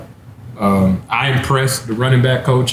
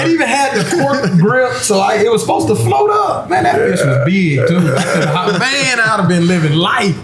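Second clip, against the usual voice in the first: laughing; 9 semitones higher than usual. Real, not acted. Laughing about the lost pole.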